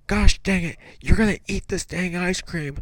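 mock-angry dad ordering. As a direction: threaten like a fed-up parent. Retold father ordering child to eat.

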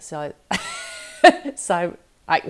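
real laughter breaking up the word; loud, giggling false starts; laughing fumble. Real, not acted. Podcaster tripping over a hard word, laughing at herself.

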